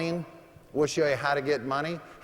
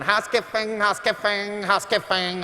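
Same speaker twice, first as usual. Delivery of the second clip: high, sing-song housekeeper call through the door; 6 semitones higher than usual. Retold or imitated. Mocking the maid knocking on his door, high chanting call. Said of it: "she's going".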